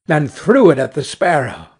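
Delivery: plain reading voice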